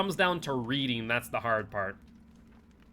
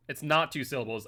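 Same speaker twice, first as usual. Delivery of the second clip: ordinary delivery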